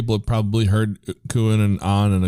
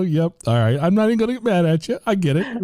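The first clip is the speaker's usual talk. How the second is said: high, fast, mock-resigned shrug; resigned acceptance; 11 semitones higher than usual. Telling the attendant a hammed-up "I get it". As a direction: brush it off with a mock sigh. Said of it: "I kinda take a breath and go".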